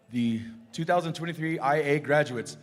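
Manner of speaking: ordinary talk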